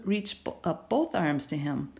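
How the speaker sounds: ordinary delivery, nothing marked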